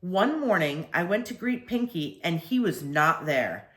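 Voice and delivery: ordinary delivery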